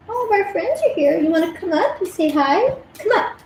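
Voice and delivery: high sing-song voice coaxing a pet; coaxing the dog. Real, not acted. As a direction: call the little dog up to you, sweet and high. Calling the dog over.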